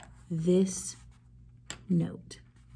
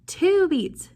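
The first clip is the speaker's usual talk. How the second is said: hammed-up gasp before two beats, high and louder; mock gasp; 8 semitones higher, 5 dB louder than usual. Shown as a demonstration. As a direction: gasp as if amazed for the kids. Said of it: "It gets".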